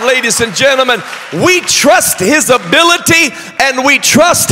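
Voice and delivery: high, loud, driving sermon climax; full-force preaching. Real, not acted. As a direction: shout the declarations of faith to the congregation.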